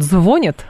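«звонят» with the stress on ЗВО; stress on first syllable. Shown as a demonstration. Host asks linguist about literacy, «звонят» stressed on ЗВО. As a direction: lean on the opening syllable of «звонят», then fall. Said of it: "будет на первый слог".

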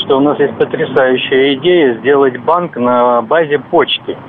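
ordinary talk, nothing marked

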